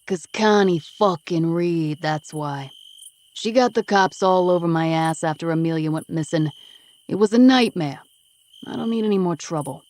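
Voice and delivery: loud, tough street guy voice; gruff East Coast guy Vinny, defensive. Acted. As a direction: tough East Coast smoker brushing her off. Vinny angry about Connie.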